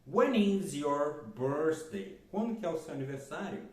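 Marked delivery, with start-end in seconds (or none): 0.1-2.2 very slow, every English word laid out clearly for students; slow teacher demo; slow model sentence. Shown as a demonstration. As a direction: spell it out slowly for the class. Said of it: "Por exemplo".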